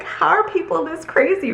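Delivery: laughing through the words; can't stop laughing as she says it. Real, not acted. Laughing at the memory.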